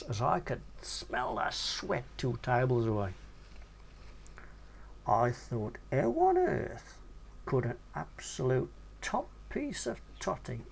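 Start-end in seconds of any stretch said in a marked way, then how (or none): 5.9-10.7 1 incredulous. A narrator's reading. Inner thought, incredulous.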